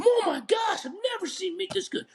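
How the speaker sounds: high, excited exclaim; amazed customer. Pretend. Customer amazed by the meat, high and excited. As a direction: gush like a thrilled customer.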